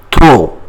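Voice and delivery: trop ending on the vowel O; silent P. Shown as a demonstration. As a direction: say "trop" firmly, leaving off the P. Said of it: "We don't pronounce P".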